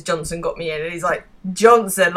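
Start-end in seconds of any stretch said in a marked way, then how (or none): 1.5-2.1 louder incredulous echo of the name; disbelieving. Retold or imitated. Jez hearing who got Jerry the bank job, disbelieving. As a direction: throw the name back in disbelief. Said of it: "the disbelief in Jeremy's demeanor".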